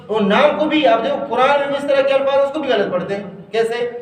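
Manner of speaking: ordinary talk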